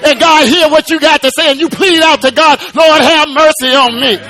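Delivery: high, sustained preacher's cadence; full-force preaching. Acted. Preacher testifying that Doctor Jesus reached into your life, impassioned high-pitched preaching. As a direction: stretch the phrases out in impassioned praise.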